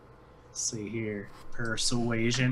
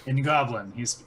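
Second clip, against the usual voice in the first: normal talking voice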